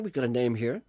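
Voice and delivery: ordinary talk, nothing marked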